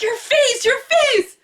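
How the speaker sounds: high alarmed cry; mom panicking. Retold or imitated. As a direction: point and shriek at the swollen face. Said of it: "She's like".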